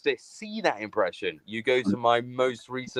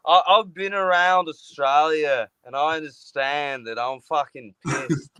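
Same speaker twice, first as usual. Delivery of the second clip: put-on drunk Australian accent. Host imitating Australians he knows, drunk Australian accent. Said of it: "like, really drunk, so everything they say".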